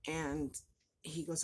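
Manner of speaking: ordinary delivery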